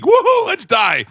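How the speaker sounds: high, loud gleeful whoop; mock excitement. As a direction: whoop it up in over-the-top fake celebration. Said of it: "I wouldn't be like".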